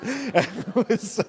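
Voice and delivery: high, loud laugh. Real, not acted. Laughing about snapping at his wife. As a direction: burst out laughing at yourself.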